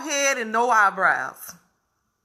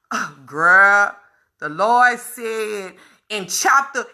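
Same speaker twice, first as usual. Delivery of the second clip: mock preachy churchgoer; 3 semitones lower, slower than usual. Mocking a preachy Bible quoter.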